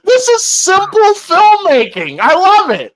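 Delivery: high, emphatic gushing; excited praise. Real, not acted. Telling Paul he loves the film, excited gushing. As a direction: rave about it to a friend.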